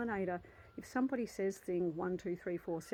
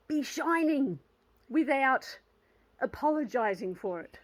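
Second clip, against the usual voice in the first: rallying close; 5 semitones higher, 7 dB louder than usual. Real, not acted. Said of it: "I'm ready".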